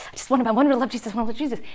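rushed, repeated plea; anxious pleading. Retold or imitated. Mom fretting over her teen's faith, anxious rapid pleading. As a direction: gush it out urgently in one breath.

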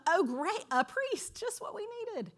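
hammed-up joy at a lucky find; mock delight. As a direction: Danites greet the priest with mock delight. Danites find a priest.

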